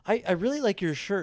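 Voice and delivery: a hint of a kid paying a compliment; young girl. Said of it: "She's like".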